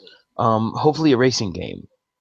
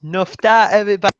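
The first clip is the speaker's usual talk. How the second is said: put-on Welsh accent; 6 semitones higher, 3 dB louder, faster than usual. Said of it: "He's trying to do a Welsh accent".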